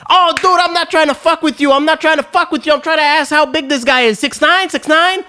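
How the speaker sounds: imitating a wired guy, high and fast; worked-up protest. Cokehead asking how big this guy is, worked-up. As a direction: gabble it high, insisting you mean no harm.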